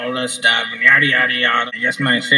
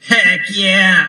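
upbeat little cheer; excited cheer; 6 semitones higher, 4 dB louder than usual. Real, not acted. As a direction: cheer it out, pleased. Cheering his fixed radio.

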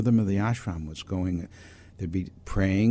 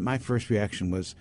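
usual speaking voice

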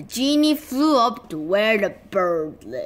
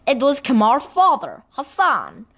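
ordinary delivery

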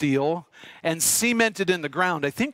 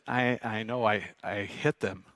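low, soft, halting confession; sheepish admission; 8 semitones lower, 7 dB quieter, slower than usual. Retold or imitated. Teen admits to older schoolmate he hit the mailboxes, low and sheepish. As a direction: own up quietly, slowing at the end.

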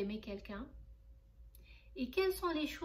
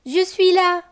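put-on child calling out, louder and higher; girl calling to her parents as she opens the door, loud; cry out; 6 semitones higher, 20 dB louder than usual. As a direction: shout it as you burst through the door. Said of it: "cria Charlotte".

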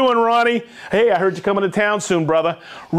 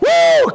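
a high, loud "woo" of real delight; excited whoop; 6 dB louder than usual. Real, not acted. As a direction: let out a loud, high cheer. Excited host cheering huge turnout.

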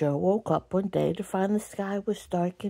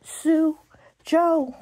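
calling out; 7 semitones higher than usual. Acted. A voice called from down below.

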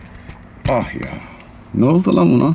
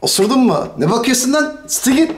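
snapping at someone; 9 semitones higher than usual. Acted.

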